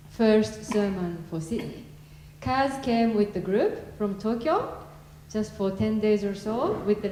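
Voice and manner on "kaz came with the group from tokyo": usual speaking voice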